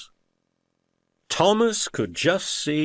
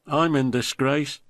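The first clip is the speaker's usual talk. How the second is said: pathetic; 7 semitones higher than usual. A narrator's reading. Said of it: "Gordon went on pathetically".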